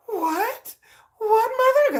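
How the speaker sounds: put-on high, scandalized kids' voice; hammed-up high-pitched shock; mock pearl-clutching shock. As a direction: imitate prim children aghast at mother. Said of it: "They were clutching the pearls".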